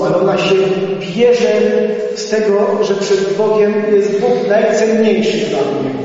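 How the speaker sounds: fervent preaching. Acted. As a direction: preach it with conviction. Preaching with rising fervour. (żarliwe kazanie)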